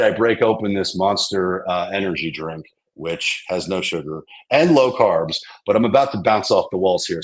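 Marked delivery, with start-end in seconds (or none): none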